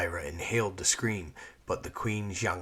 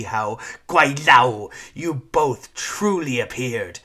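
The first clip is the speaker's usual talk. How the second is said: furious Sifu tirade; 8 semitones higher, 10 dB louder than usual. Acted. Sifu berating her former pupil, furious imperious tirade. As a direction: rant down at her like a wronged empress.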